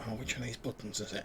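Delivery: soft, distant muttering to herself; far from the phone. Acted. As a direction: sound far off from the mic.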